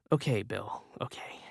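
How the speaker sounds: soft, breathy whisper of alarm; the narrator whispers to Bill, uneasy and embarrassed; tense whisper. Acted. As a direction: hush it out, trying to stay calm. Narrator whispers, shocked and confused.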